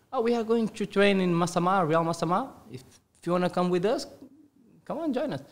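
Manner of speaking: high-pitched, coaxing imitation of friends; eager invitation. Five friends inviting him to training, eager.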